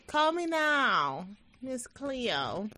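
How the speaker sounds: slow, high psychic ad voice; psychic hotline voice. Retold or imitated. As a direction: call like a TV psychic.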